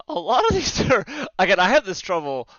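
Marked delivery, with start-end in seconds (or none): none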